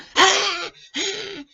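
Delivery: high wordless squawking outburst; mock upset screeching. Shown as a demonstration. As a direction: screech as if losing your temper. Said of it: "If I'm upset, I don't".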